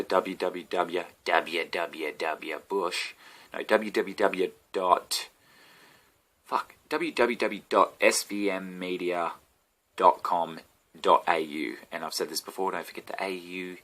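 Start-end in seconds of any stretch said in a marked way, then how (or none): 0.0-11.8 slow spelled-out address. Real, not acted. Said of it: "don't take my word for this".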